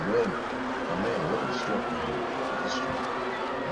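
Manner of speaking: whispered brag, slow; breathy boast. Retold or imitated. Man bragging to himself, whispered slow boast.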